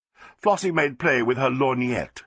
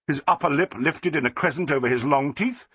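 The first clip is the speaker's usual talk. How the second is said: ordinary delivery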